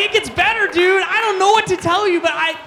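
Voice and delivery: high, loud, frantic comforting; flustered reassurance. Retold or imitated. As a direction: try to console him in a high, flustered shout. Flustered reassurance to soaked kid, acted.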